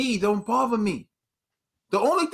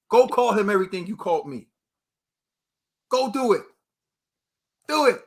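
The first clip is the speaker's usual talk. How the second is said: daring; 3 semitones higher than usual. Real, not acted. Daring commenters.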